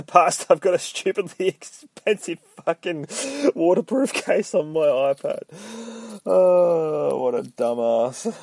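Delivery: high, laughing self-mockery; giggling at a stupid purchase. Real, not acted. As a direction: laugh at yourself through the words. Laughing at his own expense.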